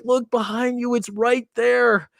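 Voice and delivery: put-on high, urgent warning to the girl. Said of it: "they're trying to be nice".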